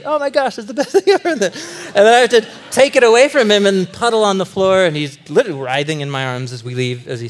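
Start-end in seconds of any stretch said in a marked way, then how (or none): 0.0-2.9 high, squealing delight; preacher voicing his delighted son; excited toddler. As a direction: gush like a two-year-old at candy. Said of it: "he's really happy".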